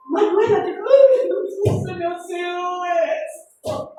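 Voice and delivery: high, alarmed call to daughter; mother asking her crying daughter; worried mother. Retold or imitated. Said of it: "Y yo".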